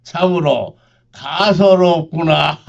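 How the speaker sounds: slow scornful sneer; mock them with contempt. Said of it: "빈정거리며 말했다".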